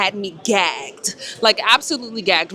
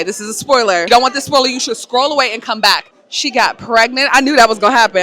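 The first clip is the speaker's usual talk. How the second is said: ordinary talk, nothing marked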